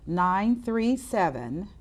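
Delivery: slow, spaced-out phone number digits. Shown as a demonstration. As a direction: say each digit slowly for people to note. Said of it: "I'm gonna say it slower".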